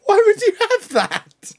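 real shocked outburst, high and loud; disbelieving shout. Real, not acted. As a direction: shout in laughing disbelief at the photo. Outraged at bear newspaper clipping.

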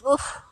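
playful woof. Pretend. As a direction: yip a greeting like a pup.

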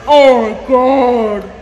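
loud, drawn-out exclamation of awe; amazed cry. Real, not acted. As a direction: stretch it out, overwhelmed. Amazed by the staging.

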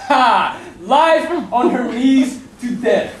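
loud, slow mocking triumph; gloating laugh. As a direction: gloat with a laugh over the beggar. Death gloats at Life begging.

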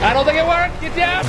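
high-pitched alarm. Real, not acted. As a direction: call out as the plan fails. Panicking as attack fails in game.

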